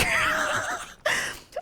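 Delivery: high, helpless laugh; uncontrolled cracking-up laugh; laughing hard. Real, not acted. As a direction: burst into real high-pitched laughter. Breaks up laughing mid-sentence.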